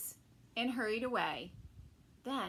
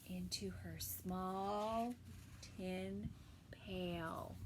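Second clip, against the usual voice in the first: hushed narration; 5 semitones lower, 8 dB quieter, much slower than usual. A narrator's reading. Quiet narration.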